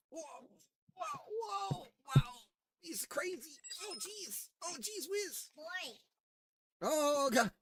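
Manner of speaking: squeaky high amazed cooing; high surprised coo. Real, not acted. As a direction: gasp at the wild dog. Pug goes crazy on camera.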